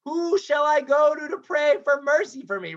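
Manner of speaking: high, plaintive mock wail; retold high-pitched helpless plea; helpless pleading. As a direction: cry out for someone to help, acted. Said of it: "This helpless sense".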